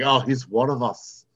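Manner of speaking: slightly put-on English accent; lightly attempted English accent; quick English accent attempt. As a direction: try a rough English accent on the fly. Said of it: "quick attempt at an English".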